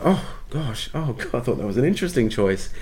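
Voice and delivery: retold sheepish, deflated protest; wounded reply. Actor answering director's vicious note, wounded.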